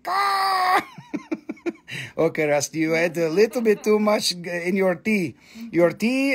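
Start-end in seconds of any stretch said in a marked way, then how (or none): 0.0-0.8 high squawking bird voice, shouted; bird cawing. Pretend. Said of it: "It's going". 0.9-2.1 squealing high laugh; high-pitched giggle. Real, not acted. Laughs after bird voice.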